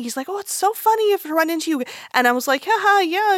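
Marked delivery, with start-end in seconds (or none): 0.3-1.8 high-pitched imitated amusement; amused older man. Older man laughing at running into her, high mock amusement. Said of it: "and he's just laughing". 2.6-3.4 high-pitched forced ha-ha; fake polite laugh. Woman humouring a stranger, high fake laugh.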